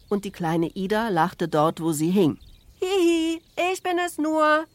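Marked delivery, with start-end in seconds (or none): 2.8-4.6 put-on high, loud giggly child's call; giggle, then call down in a piping voice; little girl shouting down. A narrator's reading. Little Ida laughs where she hangs, shouting down. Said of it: "schrie sie nach unten".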